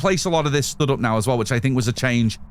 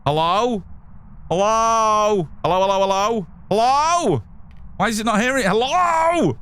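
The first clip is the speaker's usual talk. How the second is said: calling out; 6 semitones higher than usual. Real, not acted. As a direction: call out to test the mic. Mic not picking up voice.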